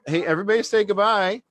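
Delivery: high, loud and quick call to the room; rally everyone to wave goodbye; cheerful call out. Real, not acted. Calling kids to say goodbye.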